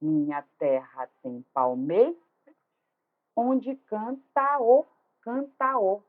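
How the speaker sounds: measured syllable beats, "palmeira" stopped on a rising "mei"; scanning aloud. Shown as a demonstration. Teacher demonstrates scansion, measured beats, fusing the vowels in "canta o". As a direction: chop the syllables, stretching "canta o" into one joined ending. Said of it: "escansão".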